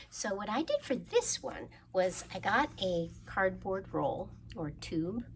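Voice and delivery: ordinary talk, nothing marked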